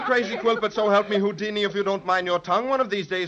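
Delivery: ordinary talk, nothing marked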